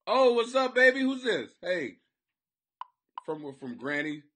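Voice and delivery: ordinary talk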